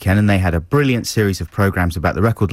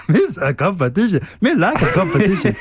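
silly Gungan voice; 10 semitones higher than usual. Acted. Continuing Jar Jar voice.

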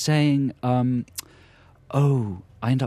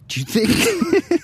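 slow, high, teasing; slow and high in mock disbelief; mock doubt; 12 semitones higher, much slower than usual. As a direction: draw it out with fake innocence.

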